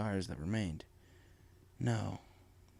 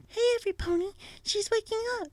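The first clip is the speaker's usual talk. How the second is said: much higher gentle character voice; a timid pony calling the others over, pitched high; Fluttershy; faster than usual. Acted. Fluttershy tells the friends Rainbow is waking, in a high put-on voice. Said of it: "she heard Fluttershy say".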